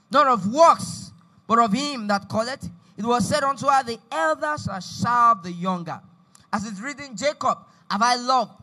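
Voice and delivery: loud, emphatic Bible reading; scripture read aloud. Acted. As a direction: proclaim the verses phrase by phrase, loudly. Reads scripture aloud to congregation.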